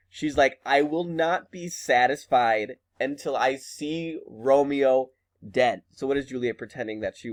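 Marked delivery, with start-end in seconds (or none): 0.7-5.8 slow, spaced-out retold vow; emphatic vow. Teacher restating Juliet's vow to the class, slow and emphatic. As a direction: draw the words out deliberately.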